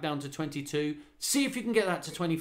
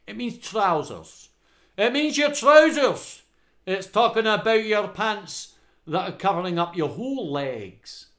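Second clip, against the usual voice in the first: put-on high, emphatic Scottish accent; 5 semitones higher, 6 dB louder than usual. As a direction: slip into a loud, exaggerated Scottish voice. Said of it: "I don't know why I went Scottish there".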